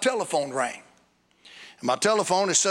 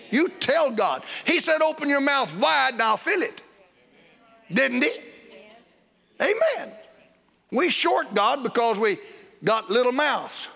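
fervent pulpit proclamation, slowing at the end; preaching at full force; 8 semitones higher than usual. Acted. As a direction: lift the congregation with a soaring preacher's cry.

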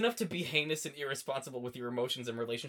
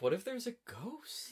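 high, slow ghost-story wondering; hammed-up wide-eyed question; mock spooked; 10 semitones higher, 3 dB quieter, slower than usual. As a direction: play at being spooked, drawn out. Acts out spooked character's question.

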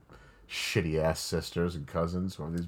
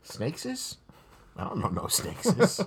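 high mock quote; 8 semitones higher, slower than usual. Quoting movie line, laughing.

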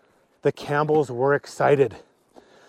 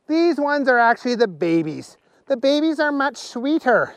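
higher-pitched character voice; lightly voiced keen host; eager Willie, reassuring; 8 semitones higher than usual. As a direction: explain a delicacy in a high, keen voice. Willie explains the worms.

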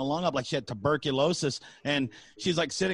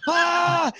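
high loud mock sneeze blast; imitated sneeze; 6 dB louder than usual. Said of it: "And it was like".

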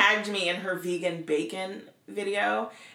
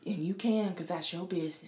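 soft dropping Tabitha voice; Tabitha's signature line to the girl; Tabitha catchphrase; 3 semitones lower, 7 dB quieter than usual. Retold or imitated. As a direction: trail off gently into the catchphrase. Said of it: "she's like".

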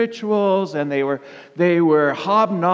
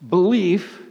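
ordinary delivery

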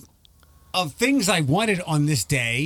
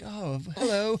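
snooty; 5 semitones higher, 6 dB quieter, slower than usual. Retold or imitated. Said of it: "your nose is like".

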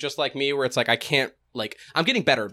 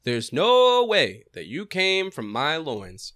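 slow, drawn-out dad pronouncement; mock stern dad; 4 semitones higher, much slower than usual. Dad disowning son in front of friends, slow and grave. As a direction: quote dad slowly and gravely. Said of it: "my dad saying".

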